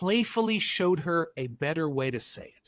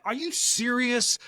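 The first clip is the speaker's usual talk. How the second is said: a touch of exasperated disbelief, higher; mad disbelief; 7 semitones higher, slower than usual. Retold or imitated. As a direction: let mild annoyance rise in the question. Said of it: "I was mad".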